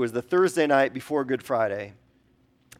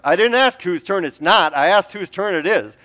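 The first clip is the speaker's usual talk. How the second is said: louder, higher exasperated retort; exasperated parent; 5 semitones higher, 7 dB louder than usual. Retold or imitated. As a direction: snap back at the kids, mock-frustrated. Parent scolding bickering kids.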